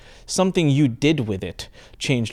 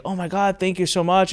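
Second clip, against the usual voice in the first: imitating a delighted mom, pitched higher; bright grateful; 6 semitones higher than usual. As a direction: play the beaming mom, overjoyed and thankful. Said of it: "bright and, like, big smile".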